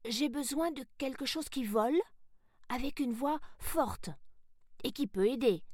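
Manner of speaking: put-on high-pitched little girl's voice; young heroine Sarah, hopeful. Sarah describes the helper she needs, high and hopeful. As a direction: list the wishes in a high, keen child voice.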